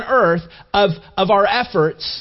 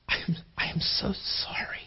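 hushed, whispered apology; barely audible, full of sorrow for someone; 13 dB quieter than usual. Acted. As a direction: whisper it with heartfelt compassion. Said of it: "you have this incredible".

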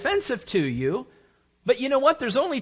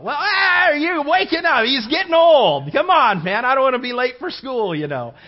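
put-on kid voice; 3 semitones higher, 8 dB louder than usual. Son answering, voiced in story.

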